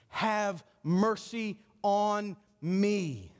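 very slow, word by word; slow, spaced-out imitated plea; drawn-out plea. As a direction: stretch each word out as an acted cry for mercy. Acting blind man's drawn-out plea.

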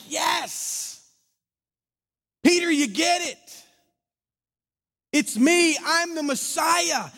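Jesus lighting up at Peter's answer; excited Jesus. Acted. Jesus telling Peter he gets it, high and joyful.

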